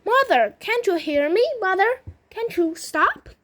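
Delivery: high, loud acted plea; storybook daughter calling out in panic; desperate pleading. A narrator's reading. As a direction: beg your mother to stop. Wailing daughter pleads with mother.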